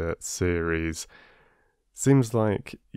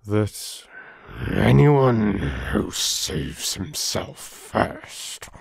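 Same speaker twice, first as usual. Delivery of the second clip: grave; slower than usual. Acted. Older traveller delivers the moral.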